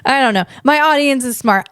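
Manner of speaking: ordinary talk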